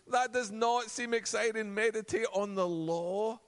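mock disappointment. Mock complaint about boring path.

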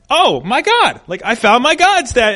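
lightly voiced child showing off a find; daughter's voice. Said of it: "And she's like".

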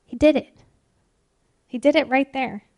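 normal talking voice

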